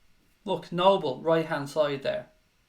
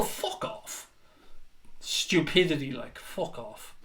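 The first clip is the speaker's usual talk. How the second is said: whispered dismissal; 2 semitones lower, 6 dB quieter, slower than usual. Real, not acted. Dismissive after mock cheer.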